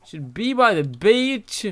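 usual speaking voice

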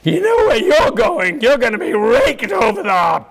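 a jeering inner demon; menacing accusing voice. Acted. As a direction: sneer like a gloating tormentor. Inner voice condemning, mock.

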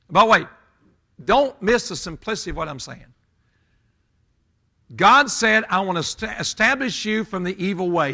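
high, loud pulpit delivery; booming sermon cadence, driving each phrase home; full-force preaching. Real, not acted. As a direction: preach it out hard, voice pitched high over the congregation.

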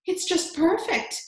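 high, stretched-out rapture; delighted cry. A narrator's reading. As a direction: gush it slowly with delight. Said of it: "she cried".

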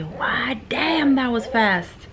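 slow mock amazement; amazed. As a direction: stretch it out in disbelief. Amazed at fast delivery.

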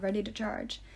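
ordinary delivery

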